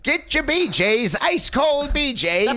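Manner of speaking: high, drawn-out sideshow call; old-timey carnival barker. Pretend. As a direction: pull in the crowd at the fair. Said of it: "Old-timey Carnival Barker".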